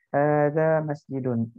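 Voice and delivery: Hadza masjidun spoken slowly with clear syllables; slow model reading. Shown as a demonstration. Teacher models vocabulary for students, slow and deliberate. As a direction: say it slowly so students can copy. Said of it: "ini masjid".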